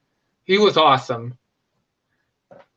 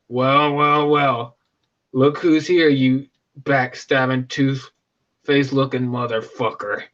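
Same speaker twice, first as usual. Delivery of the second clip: put-on low, slow menacing accent; Kabal's accent; 5 semitones lower, slower than usual. Kabal greeting an old enemy, low sneering accent. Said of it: "I loved his accent".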